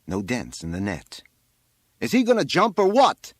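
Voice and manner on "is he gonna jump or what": high, loud demanding shout; fireman's shout. A narrator's reading. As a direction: shout it up to someone impatiently. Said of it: "the fireman shouted".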